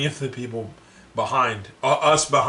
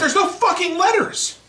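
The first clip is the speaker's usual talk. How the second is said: much higher, louder incredulous yell; exasperated outburst; 5 dB louder than usual. Retold or imitated. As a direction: throw it up high in disbelief. Exasperated at old man at checkout.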